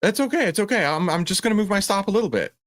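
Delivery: high, sing-song voice of a trader in denial; mock self-reassurance. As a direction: parody a trader talking himself into holding a loser. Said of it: "And you're like".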